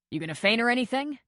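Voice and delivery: quick mock challenge; lightly voiced surgeon. A narrator's reading. Character voice in read story.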